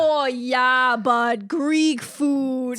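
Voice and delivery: exaggerated Dakota vowels; North Dakota accent. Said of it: "like a North Dakota accent".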